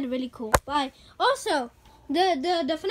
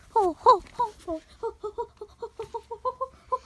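mock ho-ho laugh; 4 semitones higher than usual. Santa-like laugh run.

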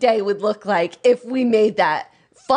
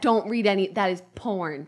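ordinary talk, nothing marked

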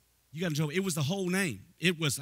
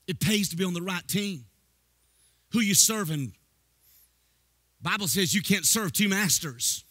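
much higher, louder sing-song preaching; preaching at full force; 10 semitones higher, 7 dB louder than usual. Acted. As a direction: drive it home like a revival preacher.

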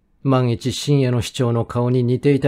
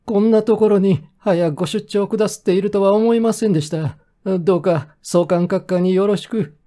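high-pitched, flustered mayor; mayor's voice; 8 semitones higher than usual. A narrator's reading. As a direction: speak as a deferential mayor, pitched well up. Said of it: "そう言うと高谷市長は".

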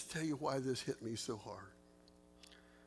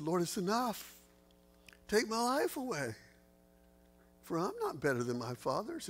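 pleading high and loud, near breaking; despairing plea; 7 semitones higher, 4 dB louder than usual. Acted. Elijah begs the Lord to take his life, despairing. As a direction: cry out to God, begging to die.

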